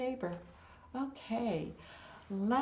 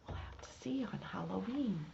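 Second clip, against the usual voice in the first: whisper; 7 dB quieter, faster than usual. Real, not acted. Secretive aside to kids.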